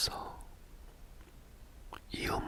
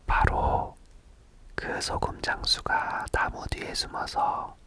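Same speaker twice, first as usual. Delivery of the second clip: plain reading voice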